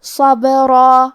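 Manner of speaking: صبرا very slow, the ب bounced, the ص heavy; qalqala on ba. Shown as a demonstration. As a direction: echo the ب in صبرا. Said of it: "ہلاتے ہوئے یہ کلمہ کو پڑھیں گے".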